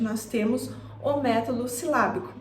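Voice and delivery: ordinary talk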